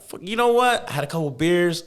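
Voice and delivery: high, fired-up self-quote; sudden decision. Retold or imitated. Deciding at the bar to enlist, high and excited. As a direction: pitch way up, going for it. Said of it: "I'm like".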